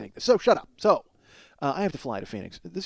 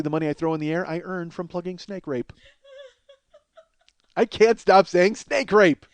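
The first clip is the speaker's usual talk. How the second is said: usual speaking voice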